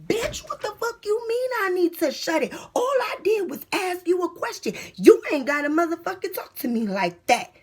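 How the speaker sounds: retold furious comeback to guard; furious retort. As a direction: let her have it.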